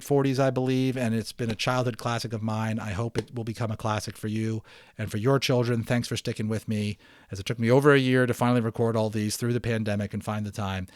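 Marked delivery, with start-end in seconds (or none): none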